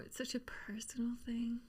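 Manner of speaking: whispered aside. Real, not acted. [name] hushing about his personal teeth, whispered. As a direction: drop to a breathy whisper.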